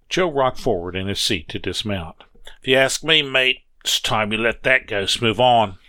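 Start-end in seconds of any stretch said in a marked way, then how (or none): 2.6-5.8 put-on old Joe, a loud, hearty start then settling; a kindly old hand reassuring his mate; old Joe comforting the narrator; gruff old railroader. Joe voiced again.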